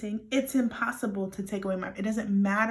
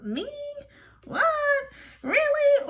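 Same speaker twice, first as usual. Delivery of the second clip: high-pitched mock disbelief; acted incredulity, pitched way up; disbelieving listener; 5 dB louder than usual. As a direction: squeak out each question, startled. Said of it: "What I'm saying to you right now is like".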